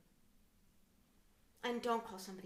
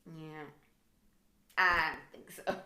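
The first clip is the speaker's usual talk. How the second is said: scornful rejection; 5 semitones lower, 5 dB louder, slower than usual. Retold or imitated. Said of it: "and it's like".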